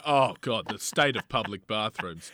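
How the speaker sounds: hammed-up snobbish revulsion; mock disgust. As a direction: sniff at it like a fussy toff. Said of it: "they go".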